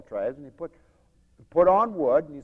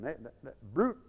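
normal talking voice

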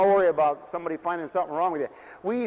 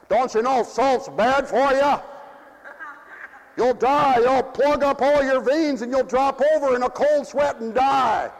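higher, louder warning ending in a shout; mock scolding; 7 semitones higher, 5 dB louder than usual. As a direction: scold them about their veins. Neighbours scolding about salt.

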